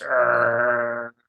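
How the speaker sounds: low mock growl; dog growl imitation. Dad mimics the sharp dog growling at kids.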